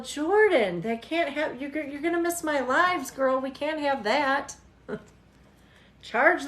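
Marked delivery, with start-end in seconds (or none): none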